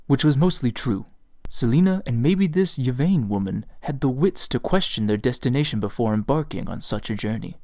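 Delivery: ordinary delivery, nothing marked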